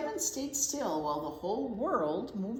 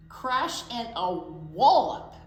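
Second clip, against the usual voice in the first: emphatic crash; 9 dB louder, slower than usual. A narrator's reading. Tree falls.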